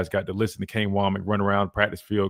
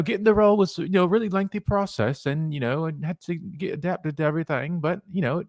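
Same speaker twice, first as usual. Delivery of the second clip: higher-pitched mock British actor voice; British accent; 6 semitones higher than usual. Radio host imitating British actors in an interview, mock British accent. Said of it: "British actors".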